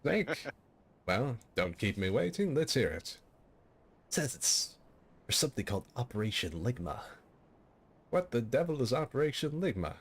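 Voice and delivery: radio commander voice. Retold or imitated. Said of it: "Snake says".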